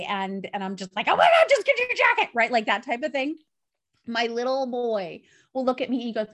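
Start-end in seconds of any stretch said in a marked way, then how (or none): 1.1-2.3 higher, louder, fast exasperated snap; frazzled mom snaps at her son; snapping. Retold or imitated. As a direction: bark it at the kid like you've lost it. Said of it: "snapping at everybody".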